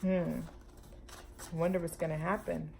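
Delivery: plain reading voice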